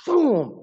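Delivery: a loud high mock punch noise; slap sound effect. Preacher imitating a smack to the face, loud and sharp. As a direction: bark out a sudden impact sound. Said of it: "imitated smack sound".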